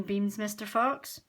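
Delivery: ordinary delivery, nothing marked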